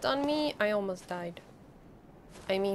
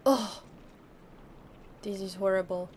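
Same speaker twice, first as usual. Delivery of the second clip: ordinary talk, nothing marked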